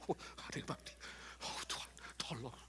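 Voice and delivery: hushed, panicked whispered prayer; a sudden fearful plea, breathed out; frightened whisper. Retold or imitated. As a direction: whisper it like a scared last-minute prayer. Panicked prayer when someone dies.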